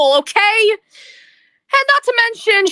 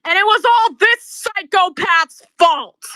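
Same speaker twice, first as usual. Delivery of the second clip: ordinary delivery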